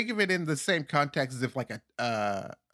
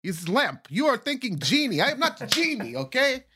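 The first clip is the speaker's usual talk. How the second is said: Akinator. Acted. Psychic game character guessing your thought, thick put-on accent. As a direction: guess minds in a hammy foreign accent. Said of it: "the voice you were doing is how I imagine Akinator sounds".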